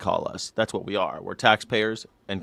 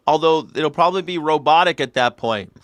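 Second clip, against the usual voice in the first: ordinary talk, nothing marked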